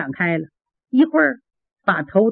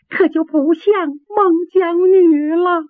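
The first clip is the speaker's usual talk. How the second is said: tearful; 8 semitones higher, slower than usual. Acted. Singer explains her stage crying.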